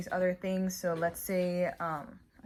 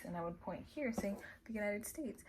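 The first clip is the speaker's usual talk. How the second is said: softer whispered aside; 4 semitones higher, 7 dB quieter than usual. Real, not acted. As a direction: drop into a whisper. Aside while explaining.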